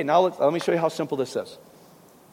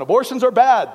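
mock protester; 6 semitones higher, 9 dB louder than usual. Mocking protest sign slogan.

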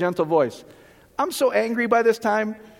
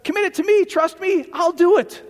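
a high, bold divine promise; voice of God; 9 semitones higher than usual. Retold or imitated. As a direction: proclaim it with confidence. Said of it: "then it says".

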